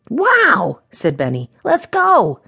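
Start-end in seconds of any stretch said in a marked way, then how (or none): 0.1-0.8 put-on high, thrilled child's exclamation; amazed little boy. Benny hearing of rubies along roads, amazed. 1.6-2.4 put-on high, raring-to-go child's voice; excited little boy. Benny keen to hunt rubies, excited.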